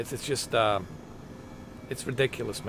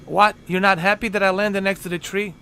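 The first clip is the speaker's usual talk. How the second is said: exasperated; 8 semitones higher, 4 dB louder than usual. Real, not acted. Scolding companion.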